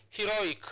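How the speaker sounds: "heroic" pitched high to show its stressed syllable; demonstrated word stress. Shown as a demonstration. Teacher repeats the example for learners, high stressed "heroic". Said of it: "you place your stress marker on this".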